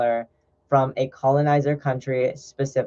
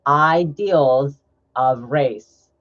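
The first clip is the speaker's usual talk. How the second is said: very slow, drawn-out "Ideals of race"; "Ideals" and "race" drawn long for weight; slow emphatic repeat; 3 dB louder, much slower than usual. Real, not acted. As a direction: stretch out each word of "Ideals of race".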